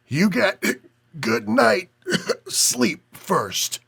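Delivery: slow, high, coughing between words; wheezing old man with Chicago accent, slowly. A narrator's reading. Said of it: "a cough talking with a heavy Chicago accent".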